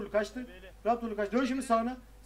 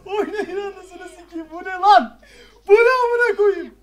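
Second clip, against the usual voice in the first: high-pitched shout, building louder; exasperated yelling; 9 semitones higher, 10 dB louder than usual. Real, not acted. Player yelling at team mid-fight, exasperated shouting. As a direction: cry out in disbelief, climbing to a yell.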